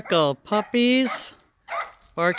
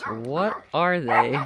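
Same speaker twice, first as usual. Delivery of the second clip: low, slow, puzzled alpaca voice; alpaca voiced; 6 semitones lower, 4 dB quieter, much slower than usual. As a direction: drag out the question as the dopey alpaca. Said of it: "He goes".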